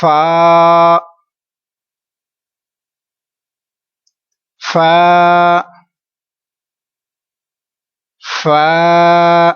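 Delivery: فا held long and loud; long model فا; stretched فا. Shown as a demonstration. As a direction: draw فا out evenly. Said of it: "لمبا کریں گے".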